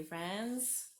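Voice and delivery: plain reading voice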